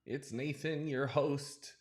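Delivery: high, slow news anchor delivery; reporter voice. Acted. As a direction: announce it like a TV host. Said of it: "I'm using my reporter voice".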